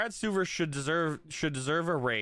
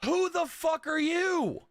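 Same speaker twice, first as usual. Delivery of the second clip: mock fan voice; slower than usual. Mocking an opposing fan.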